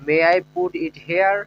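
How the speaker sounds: each word spoken slowly and clearly for learners; teacher modelling a question slowly; slow, careful English. Shown as a demonstration. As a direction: lay out every word so students can copy it. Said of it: "এইভাবে।".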